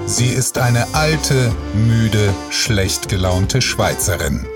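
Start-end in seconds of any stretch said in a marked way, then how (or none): none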